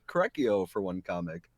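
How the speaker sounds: ordinary talk, nothing marked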